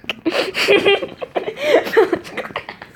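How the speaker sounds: high-pitched burst of laughter; amused squeaky laugh; high giggle. Real, not acted. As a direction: giggle at the rude word. Reader laughs at crude word.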